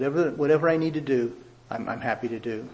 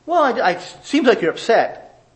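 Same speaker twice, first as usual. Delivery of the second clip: mock defensive dodge; 8 semitones higher, 6 dB louder than usual. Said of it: "What do you mean".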